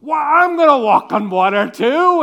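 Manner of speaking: loud, high, brash bragging; cocky boast. Retold or imitated. Peter boasting to the disciples, loud cocky brag. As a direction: brag like a show-off.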